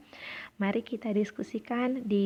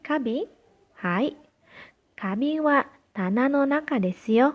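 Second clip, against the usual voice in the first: louder, higher model exchange; raised classroom reading voice; projected dialogue reading; 5 semitones higher, 6 dB louder than usual. Shown as a demonstration. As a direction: read both parts out to the class. Reading dialogue reply for students.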